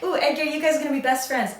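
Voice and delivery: high, sing-out mock enthusiasm; gushing. Wife telling husband about new best friends, gushing. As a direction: gush it brightly, pitched well up.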